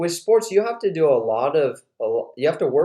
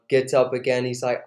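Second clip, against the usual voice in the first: usual speaking voice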